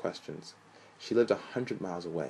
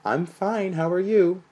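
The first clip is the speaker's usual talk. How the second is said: much higher, louder sing-song mimic; mock his high singing greeting; sing-song imitation; 12 semitones higher, 10 dB louder, slower than usual. Mother imitating her son's phone greeting, sing-song. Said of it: "It sounds like you're singing".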